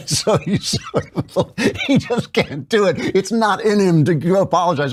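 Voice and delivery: high, giggling through the words; laughing. Real, not acted. Host laughing at Trump's half apology, giggling. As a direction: still laughing as he talks.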